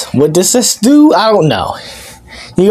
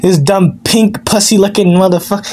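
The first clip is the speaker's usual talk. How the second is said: usual speaking voice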